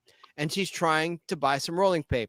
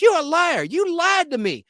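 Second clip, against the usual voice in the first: much higher and louder, slowing on the last words; indignant customer; 10 semitones higher, 5 dB louder than usual. Retold or imitated. Woman demanding rolling papers from the clerk, high indignant voice. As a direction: play the outraged lady, pitched way up.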